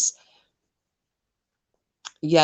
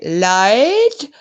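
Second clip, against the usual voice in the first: Lloyd? yelled loud and high with a falling end, in a put-on regional accent; imitating Denny's call; 6 semitones higher, 8 dB louder than usual. Copying Denny shouting for her husband Lloyd, loud accented call. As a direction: call Lloyd the way his wife does. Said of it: "Did I do the accent right?".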